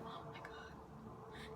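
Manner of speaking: very slow, hushed whisper; mock tearful whisper. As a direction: whisper it in drawn-out dismay. Retells crying over bleeding hand.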